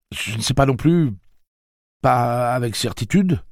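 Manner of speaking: voix haute, plus forte; Eddie hésitant. Acted. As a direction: cherche ses mots, anxieux. Voix d'Eddie. (Eddie répond à Roland, incertain)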